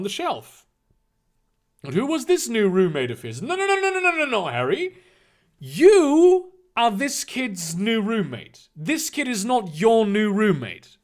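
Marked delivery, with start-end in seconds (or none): 3.4-5.0 rapid insistent no no no; emphatic no. Real, not acted. Corrects the story's wording. 5.6-8.7 YOU punched slow and loud; stress on YOU. Shown as a demonstration. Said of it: "Understand the hierarchy".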